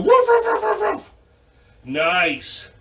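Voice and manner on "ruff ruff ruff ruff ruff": high, loud puppy barks; barking for the downward dog pose. Shown as a demonstration. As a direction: yap like a little dog. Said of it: "Stick your booty up in the air and go".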